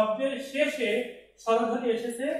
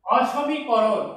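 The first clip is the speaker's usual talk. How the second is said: অসমীকরণ drawn out slowly and louder; slow, loud term; 5 dB louder, much slower than usual. Shown as a demonstration. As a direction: announce অসমীকরণ slowly to the class. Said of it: "এরপর দেখো". (ধীরে জোরে অসমীকরণ)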